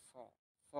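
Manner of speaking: "স" said from inside the throat. Shown as a demonstration. Teacher demonstrating pinyin CH to learners, "স" from the throat. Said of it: "এটা গলার ভিতর থেকে উচ্চারণ হবে".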